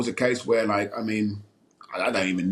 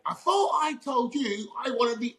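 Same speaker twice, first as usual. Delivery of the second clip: high mock shout for everyone to hear; retold shout; 12 semitones higher than usual. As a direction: bellow it across the building. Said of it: "loud as the hell, so everyone can hear".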